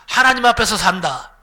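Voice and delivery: 하나님 앞에서 산다 called out loudly, 하나님 stressed with a falling end; loud call-and-response. Shown as a demonstration. Preacher leading the congregation to repeat, loud and laughing. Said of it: "한번 따라합시다".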